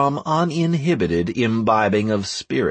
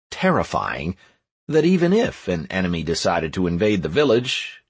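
ordinary delivery, nothing marked

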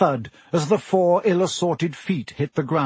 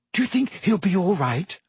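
higher, worried voice; nervous question; 5 semitones higher than usual. A narrator's reading. Hermione worrying about the injured boy, nervously. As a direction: ask anxiously, pitched up. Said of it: "said Hermione nervously".

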